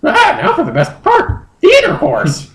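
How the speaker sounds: very high falsetto creature; squeaky bird-Pokemon voice; high Moltres voice. Acted. Moltres asking Justin to fly home, squeaky high.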